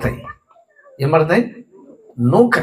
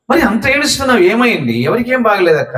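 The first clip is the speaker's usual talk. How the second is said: higher-pitched, puzzled pastor's questions; insistent questioning; 7 semitones higher than usual. Retold or imitated. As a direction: press her for the reason, pitch jumping up. Pastor presses weeping woman for answer. (ఆశ్చర్యంగా, గట్టిగా నిలదీసే ప్రశ్న)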